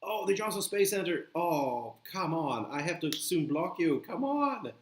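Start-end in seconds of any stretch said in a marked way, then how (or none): none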